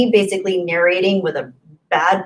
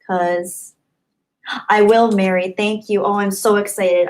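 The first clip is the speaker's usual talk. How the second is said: ordinary talk, nothing marked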